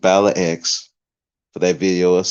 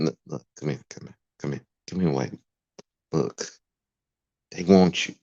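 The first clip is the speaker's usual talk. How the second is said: very soft, confidential mock coaxing; hushed beckoning; 2 semitones lower, 6 dB quieter, faster than usual. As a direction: quietly call someone aside, play-acting.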